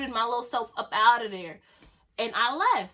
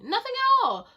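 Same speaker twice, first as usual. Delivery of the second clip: emphatic denial; 12 semitones higher, 4 dB louder than usual. Retold or imitated. Insisting nothing was going on.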